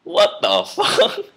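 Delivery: high, slow stunned cry with a laugh; shocked outburst. Retold or imitated. Retelling a slap from a driver, high shocked laugh. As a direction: blurt it out in disbelief, laughing. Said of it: "and I go".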